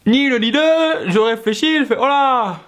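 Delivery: high-pitched inner panic; mock dread. Comedian voices his thoughts as trouble looms, mock dread. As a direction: sense disaster coming, high and hammed-up. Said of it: "dans ma tête, ça fait".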